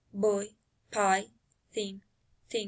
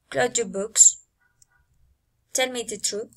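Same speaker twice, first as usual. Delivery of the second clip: commands dropping at "books" and "truth"; falling intonation. Shown as a demonstration. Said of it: "Command, falling intonation".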